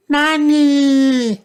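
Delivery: put-on high-pitched toddler shout. A narrator's reading. George dressing up as a mummy, high shout. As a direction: call out loud in a tiny high voice. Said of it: "shouted George".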